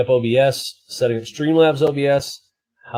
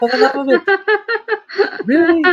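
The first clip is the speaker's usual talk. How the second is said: very high, slow squeaky pony voice; unipony voice; 4 dB louder, much slower than usual. Pretend. Streamer in unicorn filter gushing over eyeshadow, high squeaky voice.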